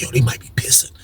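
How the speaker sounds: low, whispered heads-up; hushed warning. Retold or imitated. Roommate warns of a drug test, hushed. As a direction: pass it on softly, barely voiced.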